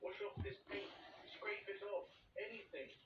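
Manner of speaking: high, begging plea; desperate pleading. A narrator's reading. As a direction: beg for help desperately. Centipede begging for help.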